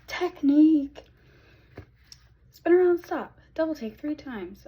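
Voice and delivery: high, loud cartoon voice, hammed up; SpongeBob voice. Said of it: "that episode of SpongeBob where he's like".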